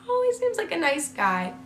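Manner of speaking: high, soft mom voice; softly cooed, trusting reply; retold naive mom. Voices her unbelieving mom.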